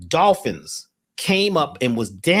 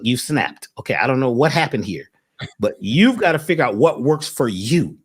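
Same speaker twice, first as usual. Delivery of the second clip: usual speaking voice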